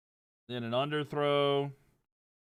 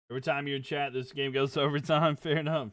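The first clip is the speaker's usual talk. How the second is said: ordinary talk, nothing marked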